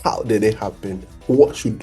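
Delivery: usual speaking voice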